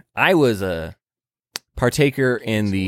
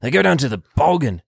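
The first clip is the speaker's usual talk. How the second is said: higher-pitched, put-on Australian accent; mock Australian accent; 5 semitones higher than usual. Guesser switching from southern to a mock Australian accent. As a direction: play a hammed-up Australian.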